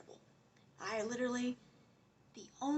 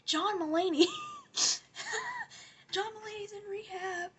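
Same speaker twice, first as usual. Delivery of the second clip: mock shock; 9 semitones higher, 4 dB louder than usual. Mock shock at rehab news.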